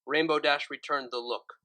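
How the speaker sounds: ordinary delivery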